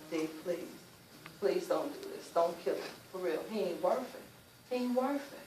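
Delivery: soft, hushed begging; mother quietly begging her son not to kill; quiet pleading. Retold or imitated. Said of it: "I'm begging him".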